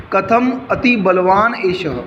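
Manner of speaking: each word of "कथम अति बलवान एषः" read slowly and distinctly; slow careful reading. Shown as a demonstration. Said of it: "इसको पढ़ने का ध्यान से देखो". (धीरे-धीरे साफ़ उच्चारण)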